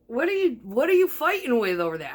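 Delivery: high, loud acted challenge; retold exasperated question. Woman asking her ex about his struggle, retold exasperated question. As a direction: call across the kitchen, pitch pushed right up.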